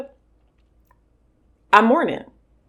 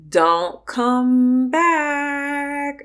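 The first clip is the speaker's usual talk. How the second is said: very slow, stretched warning; drawn-out dismissal; 6 semitones higher, much slower than usual. Real, not acted. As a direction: spell each word out. Telling off ex-friend directly.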